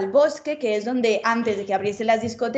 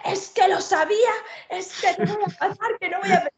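high, half-crying wail of despair; mock despair; 8 semitones higher than usual. Retelling being locked out, a mock near-tearful wail. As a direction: whimper like you're about to cry.

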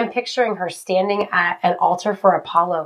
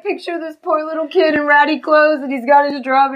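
usual speaking voice

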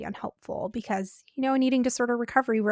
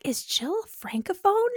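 much higher, slow, amazed voice of a fooled stranger; mock impressed listener; 11 semitones higher, slower than usual. Cohost imagining listeners fooled by her accent, mock amazement. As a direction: play someone dazzled by her French. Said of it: "They'll be like".

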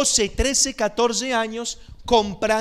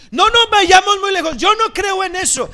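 mock skeptic; 10 semitones higher, 10 dB louder than usual. Mocking people who deny horoscopes.

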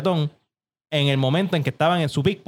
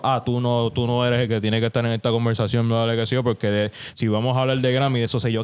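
lower, mocking the rival; lightly voiced smug artist; dismissive mock voice; 6 semitones lower than usual. Voicing the rival's dismissive claim.

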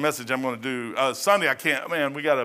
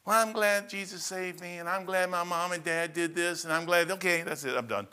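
mock lukewarm believer, higher and a touch thinner; half-hearted testimony; 5 semitones higher than usual. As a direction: parody a dutiful testimony with no heart. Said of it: "That's not a very good testimony".

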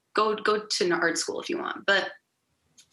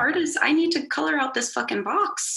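passionate; 7 semitones higher than usual. Real, not acted. Building passionate rant.

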